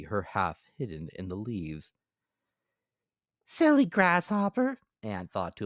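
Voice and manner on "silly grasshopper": high, louder busy little ant; high squeaky ant character. Acted. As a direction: voice the ant as small and sensible.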